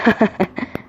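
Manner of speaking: high, gleeful laugh; mocking laugh. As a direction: laugh at the villagers. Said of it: "but Sam just laughed".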